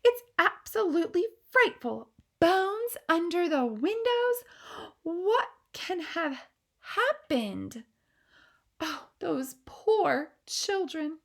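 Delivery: appalled queen. Acted. Queen lamenting the lost children, appalled. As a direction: grieve for the children.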